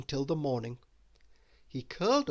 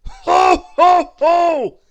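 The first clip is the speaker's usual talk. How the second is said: much higher, very loud dragon laugh; tickled dragon booming; big booming ho ho ho; 18 dB louder, much slower than usual. Acted. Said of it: "boomed the dragon".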